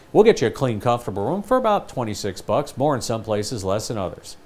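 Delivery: soft, low, relaxed conversational ad; easygoing Motel six read. Shown as a demonstration. Ad voice chatting to a guy at the bar, soft and easy. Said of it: "like they talk at Motel six".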